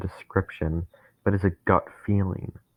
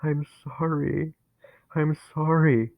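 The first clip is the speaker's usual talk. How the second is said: higher, slow, tearful apology; in tears; 8 semitones higher, slower than usual. A narrator's reading. Narrator apologizing to Dennis, in tears. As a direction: sob the apology. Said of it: "I said, fully in tears".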